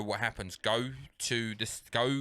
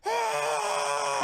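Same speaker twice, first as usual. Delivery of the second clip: high, loud held cry; big imitated crowd gasp-scream; 5 dB louder than usual. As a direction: mimic a cinema audience going wild. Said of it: "I've never heard noise like it".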